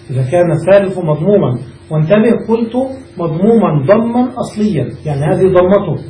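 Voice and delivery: ordinary talk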